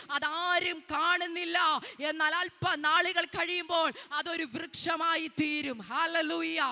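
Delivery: loud, high-pitched, building exhortation; fervent preaching. Real, not acted. Preacher assures weeping mothers of God's promise, fervent loud preaching.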